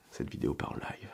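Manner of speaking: whispered aside; whispered to viewers as the video goes off the rails. Real, not acted. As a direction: breathe it out as a secret. Aside to the audience.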